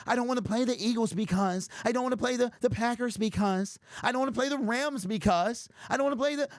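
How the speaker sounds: hammed-up mock of scared fans making excuses. Host mocking Detroit fans who fear playoff opponents, whiny. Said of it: "y'all so scared".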